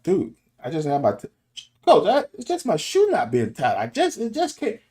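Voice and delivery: high-pitched mock protest of a player; imitated pleading, pitch jumping way up; whiny excuse. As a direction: plead your case like a player caught out. Voices a player making excuses.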